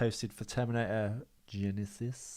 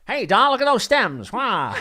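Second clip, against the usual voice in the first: high loud 1940s wiseguy; old-timey wiseguy catcalling a dame; 11 semitones higher, 14 dB louder, slower than usual. Acted. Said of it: "nineteen forties voice".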